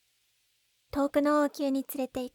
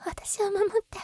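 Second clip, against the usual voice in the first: whispered plea; 7 semitones higher than usual. Acted. Begging for protection.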